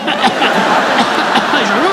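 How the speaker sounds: hammed-up coughing fit; repeated mock coughs; threatening fake cough. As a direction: cough at someone on purpose.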